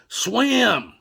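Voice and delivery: high, loud imitation of an aunt's order; shouted command. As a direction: holler it out to a child in the water. Aunt calls out to struggling child.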